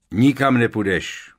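gruff giant putting his foot down; growling refusal. A narrator's reading. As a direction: growl it out forcefully. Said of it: "zavrčel Hagrid".

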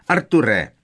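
high, loud yelp of a name. A narrator's reading. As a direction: cry out the name sharply. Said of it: "vyjekla paní Weasleyová".

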